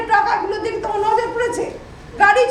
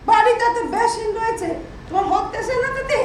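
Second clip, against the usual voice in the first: normal talking voice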